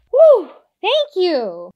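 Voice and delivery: loud, high relieved whew; big cartoon relief. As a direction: gasp out your thanks. Relieved after soft landing.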